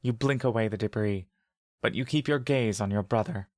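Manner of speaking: plain reading voice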